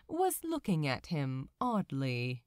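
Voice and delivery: usual speaking voice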